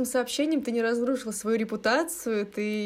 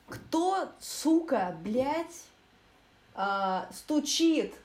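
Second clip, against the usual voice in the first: higher, irritated swearing at the door; real irritation at someone knocking; annoyed outburst at the knocking; 6 semitones higher, much slower than usual. Real, not acted. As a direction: snap at whoever is knocking.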